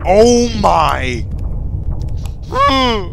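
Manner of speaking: high loud startled cry; startled yelp. Real, not acted. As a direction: jolt with fright. Jump scare in game.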